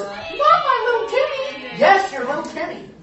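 mock offended parent; doting parent. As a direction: gush it like a mother defending her boy.

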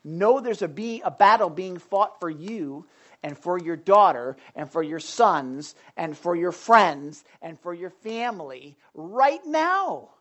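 ordinary talk, nothing marked

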